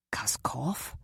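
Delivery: stunned, whispered name; shocked whisper. A narrator's reading. Jock hears Kara name Kaskov, whispering in shock. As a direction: drop to a tense whisper.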